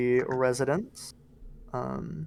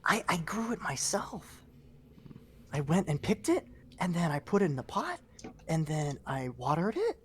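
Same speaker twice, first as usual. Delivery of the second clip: put-on soft child voice; 6 dB quieter than usual. Child character telling story.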